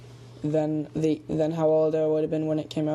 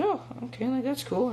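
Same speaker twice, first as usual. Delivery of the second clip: lightly voiced child, pitched up; higher, casual little-kid reply; eight-year-old voice; 7 semitones higher than usual. Child answering mom about famous people, lightly voiced kid. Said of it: "in my eight-year-old voice".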